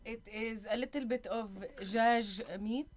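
djaj said the Arabic way. Acted.

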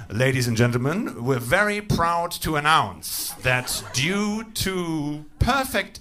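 slow, deliberate German announcer; German train announcement. Acted. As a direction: announce it proudly, stretching out the key words. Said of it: "The German equivalent of that announcement".

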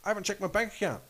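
high-pitched imitated excuse; waitress's excuse. Waitress answering the furious wife, mock innocent. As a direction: lightly act a clueless defence, voice way up.

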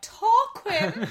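posh drawn-out Tarquin; imitating Ryan. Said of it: "the way Ryan says it as well".

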